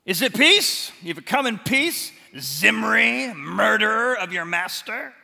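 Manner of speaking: loud, high mocking challenge from the window; scornful taunt. Queen taunting Jehu from her window, loud mocking sneer. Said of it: "Jehu looks down at him and says".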